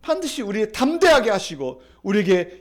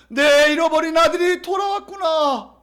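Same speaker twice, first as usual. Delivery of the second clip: acted joyful cry; 7 semitones higher, 5 dB louder than usual. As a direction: cry out in overjoyed welcome. Father celebrates returned son. (기쁨에 차서 길게 외치는 소리)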